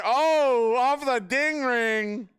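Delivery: high, loud, drawn-out call; excited shout. Real, not acted. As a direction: scream the goal call, slowly. Goal scored at the end.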